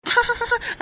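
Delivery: shrieking high laugh of delight; high cackle. Acted. Driver bursts out laughing at the terrified passenger, very high cackle. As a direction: cackle at her terror.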